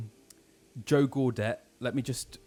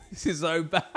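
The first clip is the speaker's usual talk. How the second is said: higher, breaking into laughter; laughing; 8 semitones higher than usual. Real, not acted. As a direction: crack up at your own bad acting. Cracks up at own impression.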